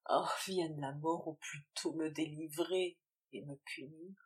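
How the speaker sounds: usual speaking voice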